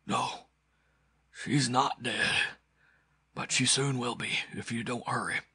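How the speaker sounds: halting urgency of a dying man; tortured wounded man. A narrator's reading. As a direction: force it out through agony.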